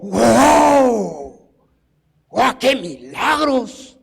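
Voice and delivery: drawn-out, marvelling wow; slow, wide-eyed awe at a miracle; amazed disciple. Acted. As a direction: act out an awestruck onlooker. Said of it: "Seguramente él dijo".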